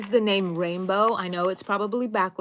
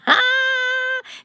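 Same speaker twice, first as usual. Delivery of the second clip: squealing laugh, higher and louder; 9 dB louder than usual. Real, not acted. Teasing Laura about staying up, a squealing laugh. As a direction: burst into a bright laugh. Laughing at her own tease.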